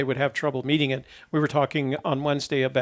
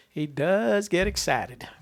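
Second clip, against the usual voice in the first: slow, higher, stretched-out delivery; drawn-out repeat; 4 semitones higher, slower than usual. Real, not acted. Host repeating his remark on an excitable searcher, slow and drawn out. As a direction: stretch the words out teasingly.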